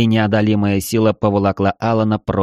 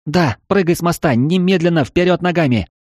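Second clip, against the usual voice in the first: urgent shouted command; 9 semitones higher than usual. Acted. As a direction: yell the order to jump now. Eva urgently commands jumping.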